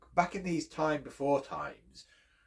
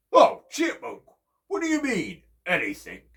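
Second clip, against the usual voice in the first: much higher, louder exclaim; bear surprised; 11 semitones higher, 10 dB louder than usual. Acted. Bear answering tiny Chipmunk, higher and louder. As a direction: sound taken aback.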